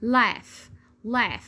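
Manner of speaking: "laugh" closing on a clear f; spaced model word "laugh", f ending; final GH as F. Shown as a demonstration. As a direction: repeat "laugh" as "laf". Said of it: "عملت معايا صوت ال".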